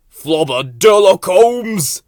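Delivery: slow, loud proud burble; flowerpot detective gibberish. A narrator's reading. Bill declaring himself a detective, loud slow gibberish. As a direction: announce it like a grand detective.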